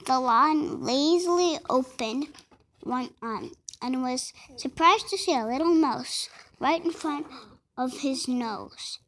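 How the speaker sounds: story reading. Acted. Reads the fable aloud.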